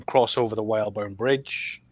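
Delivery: ordinary talk, nothing marked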